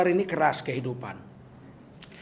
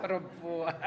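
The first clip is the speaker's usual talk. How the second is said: ordinary talk, nothing marked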